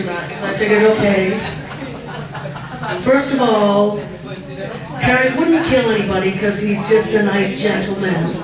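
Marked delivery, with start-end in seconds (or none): none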